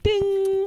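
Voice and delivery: high, ringing imitated chime; ping it like a gleaming cartoon grin; smile sparkle ding. Co-host mimicking Tom Brady's smile on Family Guy, sparkle ding. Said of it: "he has that smile, like".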